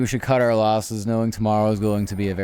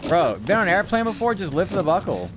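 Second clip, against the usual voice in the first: mocking; 10 semitones higher, 5 dB louder than usual. Real, not acted. Mocking clumsy game character.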